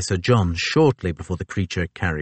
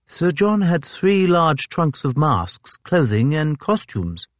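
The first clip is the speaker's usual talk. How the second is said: higher, slow officer's voice; slow, higher gentleman officer; Fitzjames voice; 8 semitones higher, slower than usual. A narrator's reading.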